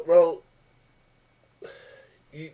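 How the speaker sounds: ordinary delivery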